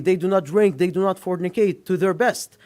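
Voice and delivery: usual speaking voice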